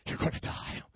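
ordinary talk, nothing marked

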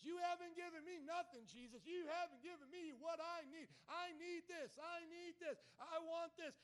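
high, loud demanding tantrum; yelling back. Acted. Believers complaining back at Jesus, acted-out yelling. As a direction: yell each demand like an ungrateful child. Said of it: "we're yelling back at Jesus".

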